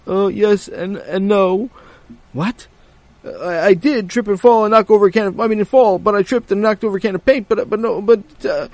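high, stammering, loud excuses; flustered kid. Acted. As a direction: stumble over the excuse.

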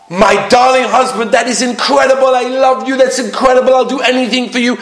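high-pitched mock adoring wife; gushing praise. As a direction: gush with exaggerated delight. Imagined wife gushing over husband.

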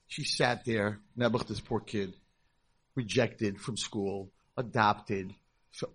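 normal talking voice

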